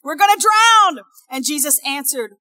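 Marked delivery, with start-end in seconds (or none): 0.0-1.0 high, loud, drawn-out cry for help; hammed-up desperate plea to be saved; panicked shouting. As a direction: shout as if the boat is sinking. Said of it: "woke him up shouting".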